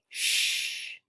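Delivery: "Shh" as a long voiceless hush; sh sound. Shown as a demonstration. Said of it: "and instead they say".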